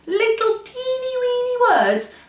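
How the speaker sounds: high, tiny, cutesy voice for the little words; squeaky little teeny voice; teeny-weeny voice. Acted. Describing tiny words playfully.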